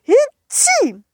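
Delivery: squeaky high sneeze, drawn out. Acted. Snowman sneezes. (hohes, langgezogenes Niesen)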